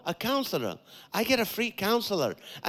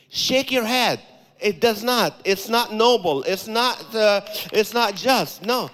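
forceful preaching; 4 semitones higher, 6 dB louder than usual. Real, not acted. Preacher forcefully rejecting thought.

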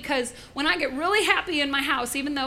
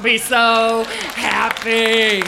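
blissful emphasis; 2 semitones lower, 8 dB louder, much slower than usual. Acted. Gleeful promise about dessert.

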